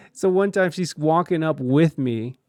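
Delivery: normal talking voice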